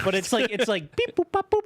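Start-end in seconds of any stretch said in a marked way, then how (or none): 1.1-1.6 beeping noises. Retold or imitated. Imitating keypad beeps.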